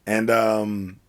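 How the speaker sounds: ordinary talk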